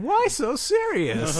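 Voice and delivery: high, slow, laughing Joker impression. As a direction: drawl it out like the Joker. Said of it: "They go over to Batman".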